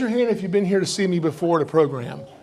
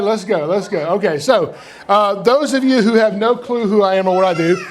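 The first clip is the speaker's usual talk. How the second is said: usual speaking voice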